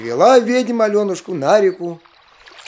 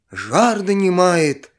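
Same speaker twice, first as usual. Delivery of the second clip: plain reading voice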